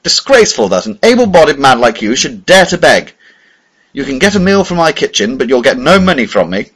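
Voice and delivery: loud, high, indignant rebuke. A narrator's reading. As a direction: raise your voice in disgust. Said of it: "he said, raising his voice".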